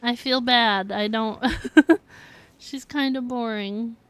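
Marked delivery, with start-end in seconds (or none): 1.5-2.5 short, loud, high giggle; real laugh breaking off the sentence; guilty laugh. Real, not acted. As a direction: burst into a sheepish giggle. Guilty laugh about finding character boring.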